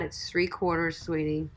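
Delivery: ordinary delivery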